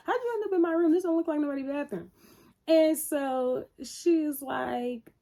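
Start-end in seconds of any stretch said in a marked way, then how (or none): 0.1-2.1 high, quick mock disbelief; rapid, pitched-up retold challenge; mock incredulous. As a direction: imitate someone incredulously questioning a snooper. Acts him questioning the snooper.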